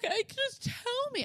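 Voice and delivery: high-pitched imitation of a whiny child; mock whining. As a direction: plead in a put-on kid's whine. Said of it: "with the loud whining".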